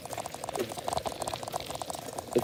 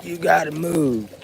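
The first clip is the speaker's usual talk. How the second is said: higher, pushy voice telling them to move; mock order to move; 6 semitones higher than usual. Man recalling being told to move at the auction, mock bossy order. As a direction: bark out the order to move along. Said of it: "they were like".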